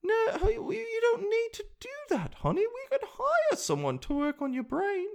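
sky-high British grandmother falsetto; the Queen. Acted. Queen talking her grandchild out of surgery, high mock-regal falsetto. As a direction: coddle in a very high, fluting put-on voice. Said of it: "you could go to the queen".

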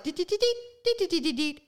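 high sing-song deet noises; mimicking a drunk's dance. Imitating the drunk guy hopping around the paella, high sing-song deets. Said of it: "he literally, like".

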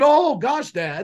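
a lightly voiced kid who just got burned; imitating a startled child; shocked child. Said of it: "they look at you horrified".

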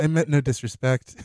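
mock formal apology.

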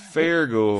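put-on Fargo said with a Minnesota accent. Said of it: "That is Fargo".

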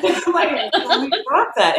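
high, laughing through the words; giggling as she backs out. Real, not acted. Telling a friend she is dropping French, high and laughing.

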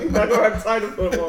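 high, loud, fast through real laughter; laughing. Real, not acted. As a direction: rush it out while cracking up. Laughing over the motel riff.

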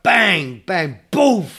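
loud punchy bang, bang, boof noises; mock bang sound effects. Friend recreating the film's punches, loud mock bangs.

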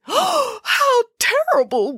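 high, drawn-out whimper. A narrator's reading. Boris reacts to the bakery not existing, whimpering. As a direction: whimper it slowly and high, aghast. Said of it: "whimpered Boris".

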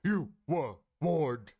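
slow, loud, booming doom. Acted. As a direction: boom out the warning slowly. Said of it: "Like that".

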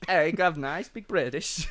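low, badly faked British accent; half-hearted stab at British; barely British accent. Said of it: "you're barely doing the accent".

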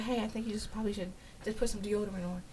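very soft, gentle retold suggestion; caring tone. Partner privately suggesting deodorant to him, soft and caring. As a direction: break it to him softly, almost under your breath. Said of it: "like, in a caring tone".

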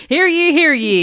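loud ringing proclamation; town crier. Pretend. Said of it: "someone shouting out from the streets".

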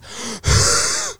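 big hammed-up gasp for air, high and breathless; loud gasp. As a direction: suck in air like you forgot to breathe. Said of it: "that was probably terrible on the mic".

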